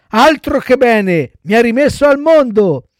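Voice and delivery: put-on high, loud happy little boy; elated child puppet. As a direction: exclaim it with childish zest. Cured Pinocchio answers the fairy gleefully. (burattino allegro, voce acuta)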